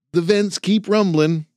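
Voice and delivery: put-on young man voice. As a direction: report it like a keen recruit. Jason reports.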